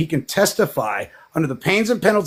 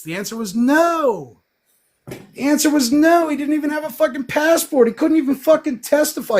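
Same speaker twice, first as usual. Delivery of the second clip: heated rant; 10 semitones higher than usual. Real, not acted. Ranting at the absent expert witness.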